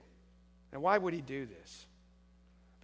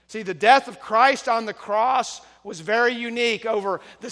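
loud, high, impassioned preaching; full-force preaching; 8 semitones higher, 14 dB louder than usual. Real, not acted. Preacher urging the church to choose to love God, full-force preaching. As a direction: preach it hard, voice raised and driving.

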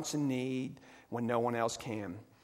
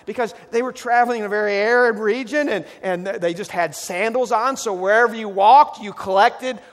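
fervent preaching, much louder; 6 semitones higher, 10 dB louder than usual. Real, not acted. Pastor preaching loudly to the church. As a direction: drive it home to the congregation. Preacher building to full force.

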